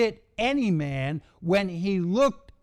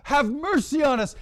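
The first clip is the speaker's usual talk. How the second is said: pleading for mercy; 7 semitones higher than usual. Acted. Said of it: "They come to their senses and they repent".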